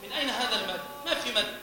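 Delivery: ordinary delivery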